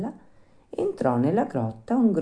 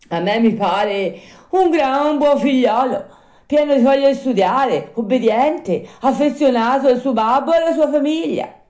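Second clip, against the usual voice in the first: high, loud puppet voice; insistent high-pitched boasting; self-praising puppet; 12 semitones higher, 8 dB louder than usual. Acted. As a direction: lay the praise on emphatically.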